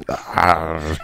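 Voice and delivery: ordinary delivery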